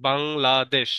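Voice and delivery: Bangladesh slowly in three beats, বাং, লা, দেশ; split into syllables. Shown as a demonstration. Teacher counting three syllables, Bangladesh slowly broken as বাং — লা — দেশ. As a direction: separate each syllable of Bangladesh. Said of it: "তার মানে এখানে তিনটা সিলেবল রয়েছে".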